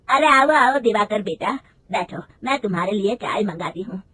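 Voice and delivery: a hint of a bossy elder calling out; lightly voiced mother-in-law. A narrator's reading. Mother-in-law greets, calls bahu.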